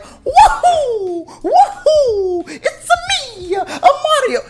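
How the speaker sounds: very high, whooping mock Italian accent; Mario. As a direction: whoop it up as an over-the-top Italian plumber. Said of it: "would get up there and be like".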